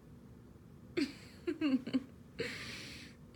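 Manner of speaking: bright, breathy giggle; happy laugh. Real, not acted. Girlfriend delighted by her partner's answer, laughing. Laughs after asking do you love me.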